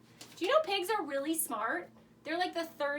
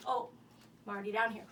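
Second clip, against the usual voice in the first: ordinary talk, nothing marked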